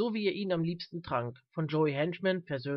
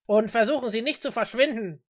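raised, higher warning; loud police order; commanding; 5 semitones higher, 7 dB louder than usual. Acted. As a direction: warn them loudly, as an officer in charge. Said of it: "befahl der F-B-I-Beamte".

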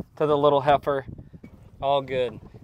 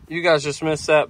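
ordinary talk

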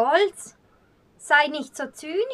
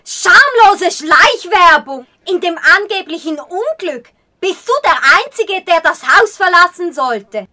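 angry retort; 3 semitones higher, 9 dB louder than usual. Acted.

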